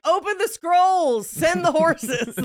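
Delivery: high, loud, drawn-out proclamation; mock doomsday cry. Bored listener heralding the end of the world, mock proclamation. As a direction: call it out like a hammed-up herald.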